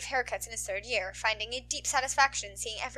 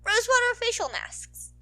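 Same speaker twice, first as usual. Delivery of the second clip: put-on teasing girl voice; 11 semitones higher, 4 dB louder than usual. Ochaco's teasing punchline.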